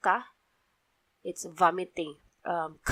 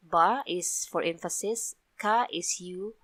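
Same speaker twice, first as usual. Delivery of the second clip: normal talking voice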